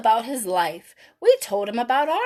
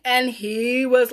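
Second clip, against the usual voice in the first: ordinary delivery, nothing marked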